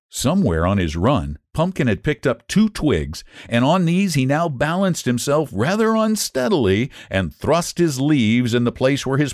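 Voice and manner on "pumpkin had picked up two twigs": usual speaking voice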